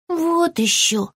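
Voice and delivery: high, slow, huffy dismissal; a touch of indignation; mildly cross squirrel. Acted. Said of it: "рассердился бельчонок".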